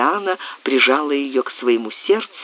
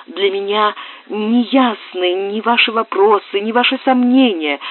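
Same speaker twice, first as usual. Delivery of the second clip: put-on higher agitated girl's voice; young woman Susanna; 7 semitones higher than usual. Susanna confiding in the lord, higher pleading voice. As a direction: plead as a frightened young girl.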